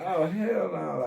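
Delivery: high, slow retold disbelief; startled refusal. Waking among strangers in jail, startled refusal. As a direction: draw it out high, refusing what you see.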